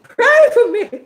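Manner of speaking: high wailing mock plea; high cry-laughing mock prayer plea; mocking her plea. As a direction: whine it like a fake sob. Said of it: "she on there like".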